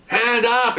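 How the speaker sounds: high barked police command; yelling command. Retold or imitated. Officer yelling at the suspect to raise hands. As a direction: shout it at the suspect. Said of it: "I'm yelling".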